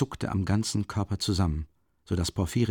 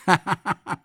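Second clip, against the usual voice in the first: short, higher teasing laugh; sly laugh; 8 semitones higher than usual. Acted. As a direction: laugh knowingly at him. Investigator laughs at suspect.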